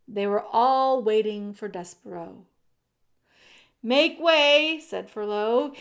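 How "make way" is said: loud, raised herald's call. A narrator's reading. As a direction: clear a path through the crowd. Furlow calling through the crowd.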